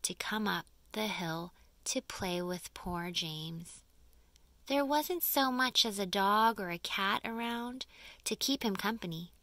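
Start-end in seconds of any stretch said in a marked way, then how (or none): none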